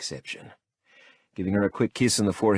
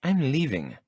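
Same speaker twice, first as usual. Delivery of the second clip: Lola's voice; 8 semitones higher than usual. A narrator's reading. Lola voiced in the story.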